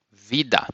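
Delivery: Vida as VEE-da; Portuguese vida. Shown as a demonstration. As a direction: model word Vida. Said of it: "you say vida".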